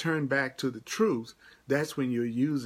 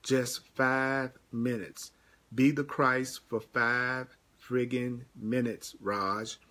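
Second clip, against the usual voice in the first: slow, insistent plea; slower than usual. Real, not acted. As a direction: stretch each word out, pressing.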